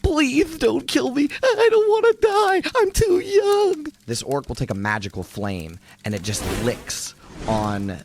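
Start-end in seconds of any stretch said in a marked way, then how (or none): 0.0-3.9 a terrified little man begging for his life; pleading Floon. Acted. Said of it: "Floon ... being like".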